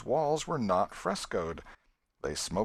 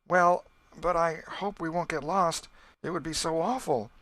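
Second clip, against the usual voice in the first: put-on higher and louder, a shuddering girl's dread; fearful; 9 semitones higher, 5 dB louder than usual. A narrator's reading. Becky to Tom, afraid of getting lost, higher and fearful. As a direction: dread breaking through the words. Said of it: "the girl shuddered at the thought".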